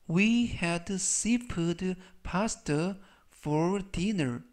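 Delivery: very slow, deliberate English sentence; slow model reading. Shown as a demonstration. Teacher modelling a sentence slowly for learners. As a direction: read it out word by word. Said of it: "우리는 저녁으로 해물 파스타를 먹었다라는".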